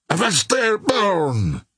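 high-pitched hailing call; shouted. A narrator's reading. Officer hailing an armed sailor below, shouting. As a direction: call down sharply to halt him, pitched high. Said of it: "he shouted".

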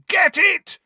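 high, loud bellow. Acted. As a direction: bellow the command with all you've got. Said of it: "Harry bellowed".

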